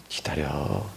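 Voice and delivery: very low, soft, patient hush; gently calm a hungry child, voice dropped right down; acted parental reassurance; soothing parent. Mother calms hungry child.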